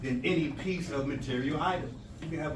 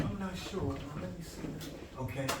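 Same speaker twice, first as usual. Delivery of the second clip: quiet pondering; 8 dB quieter than usual. Acted. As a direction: mull it over under your breath. Acting someone pondering quietly.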